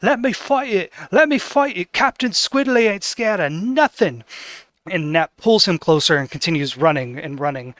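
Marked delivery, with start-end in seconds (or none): 0.0-4.2 put-on high, defiant little boy voice; a small boy puffed up, begging to fight; excited child shouting to fight the ghost; eager kid hero. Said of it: "Squidly struggles ... and says".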